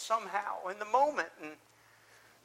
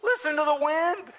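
alarmed; 4 semitones higher, 7 dB louder than usual. Acted. Peter's alarmed thoughts while walking on water.

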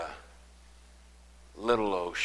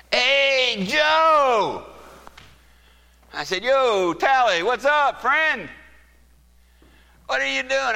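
calling out; 10 dB louder, slower than usual. Retold or imitated. Shouted greetings across the lot.

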